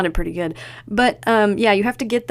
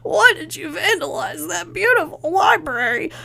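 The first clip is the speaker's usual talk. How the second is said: higher, coughed-out Nancy line; coughing Nancy; 11 semitones higher than usual. Retold or imitated. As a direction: choke it out like Nancy after the spray.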